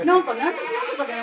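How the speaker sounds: fast, laughing gibberish in Fukui dialect; mock Fukui dialect. Teacher mimics locals at the soba shop, fast Fukui dialect. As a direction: rattle off half-heard Fukui dialect, amused. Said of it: "福井弁を喋る人だった".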